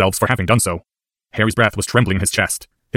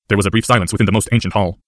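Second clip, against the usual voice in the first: plain reading voice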